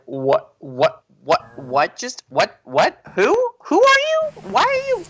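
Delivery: sputtering mock disbelief, climbing very high; stammer, then squeak the questions out; stunned bafflement. Retelling own face on the video, acted speechless shock. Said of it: "you could see my face".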